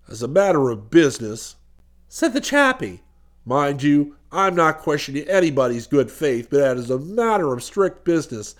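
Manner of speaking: stout, suspicious businessman, pitched low; determined businessman. A narrator's reading. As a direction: press the point in a low, businesslike voice. Voices brooding businessman challenging the duke.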